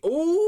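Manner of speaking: high, loud, long ooh; sustained high-pitched teasing ooh; taunting ooh. Pretend. Teasing someone who was late, high loud ooh. Mock reaction to latecomer.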